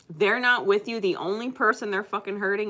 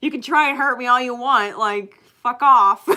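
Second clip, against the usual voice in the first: louder, higher defiant quote, half laughing; defiant retort; 5 semitones higher, 10 dB louder than usual. Retold or imitated. Retelling what she told him, loudly defiant. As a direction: throw the mock defiance back at him. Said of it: "I was like".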